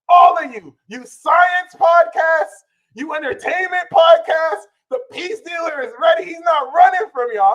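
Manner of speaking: high, loud defiant challenge; challenging shout. Real, not acted. Challenging live TV hosts with a loud defiant shout. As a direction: call them all out loud.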